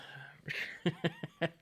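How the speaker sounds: light, breathy real chuckle; a short rippling laugh. Real, not acted. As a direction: chuckle to yourself in a quick run. Chuckles at fifteen years on YouTube.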